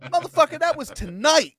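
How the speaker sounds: high, loud mock outrage; imitating angry yell. Quoting [name] yelling at [name], mock outrage. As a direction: blow up in mock fury. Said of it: "He's like".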